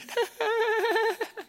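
put-on high, nervous little-girl giggle; a child cracking under tension with a high giggle. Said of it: "And she starts giggling".

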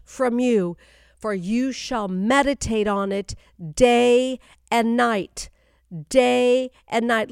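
loud, emphatic preaching with punched key words; impassioned preaching. Real, not acted. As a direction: preach it loud, driving each phrase home. Preaches at full force.

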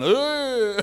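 high, put-on startled ooh; high, hammed-up uneasy ooh; mock wary ooh. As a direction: imitate the shocked churchgoers. Church folk reacting to him.

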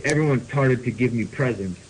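ordinary talk, nothing marked

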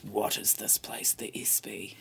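soft, low, almost whispered wondering; retold hushed question; hushed curiosity. Retells hushed awe at venue.

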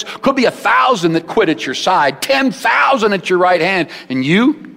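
loud, high, driving sermon voice; fiery preaching. Acted. Pastor preaching joy to the congregation, loud and driving. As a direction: preach it out with full force.

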